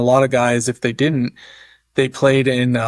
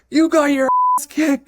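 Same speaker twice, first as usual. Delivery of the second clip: high, sing-song jeering voice; mocking taunt; 12 semitones higher than usual. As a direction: tease him in a squeaky ribbing voice.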